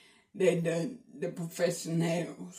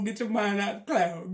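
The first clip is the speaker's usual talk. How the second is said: ordinary delivery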